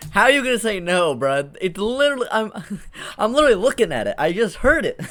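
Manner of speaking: loud, high-pitched disbelief; can't believe what you're hearing, voice jumping up; incredulous. Real, not acted. Reacts in disbelief to denial.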